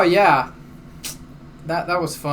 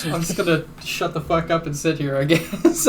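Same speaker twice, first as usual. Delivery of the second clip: ordinary talk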